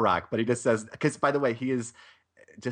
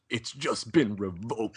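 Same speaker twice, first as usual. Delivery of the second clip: slow, softer action-hero comeback; Glover impression; 5 dB quieter, slower than usual. Co-host answers with his Murtaugh line, slow hero impression. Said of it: "it might sound a little something like this".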